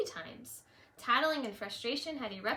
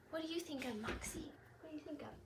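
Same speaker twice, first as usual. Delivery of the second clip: gentle aside to dog; 5 semitones higher, 10 dB quieter, faster than usual. Real, not acted. Asks dog quietly aside.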